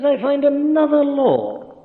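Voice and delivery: "another" given weight, slow and set apart; single out "another" as a different kind of law; stress on another. Shown as a demonstration. Preacher quoting Paul, slowly stressing "another". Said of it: "another doesn't mean just another numerically".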